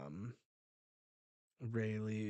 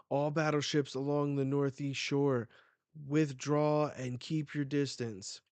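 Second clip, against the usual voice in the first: loud, raised announcement voice; acted military order; 4 semitones higher, 7 dB louder than usual. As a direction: call the order out to a whole fleet. Military order read from manga.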